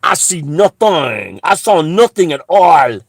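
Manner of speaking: feigned ignorance. Retold or imitated. French officials turning a blind eye, loud mock denial. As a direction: play the guard who looks the other way, loudly. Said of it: "facilitating it by going".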